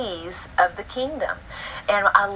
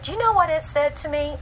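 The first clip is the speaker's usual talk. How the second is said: put-on higher childlike question; little girl voice; 8 semitones higher than usual. Mother voicing her daughter telling the story, high child voice. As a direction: ask it like an eager four-year-old.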